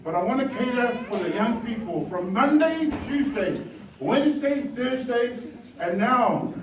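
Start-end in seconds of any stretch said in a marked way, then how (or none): none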